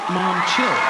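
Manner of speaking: put-on an annoyed kid telling mom to relax; mock teen. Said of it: "he's just like".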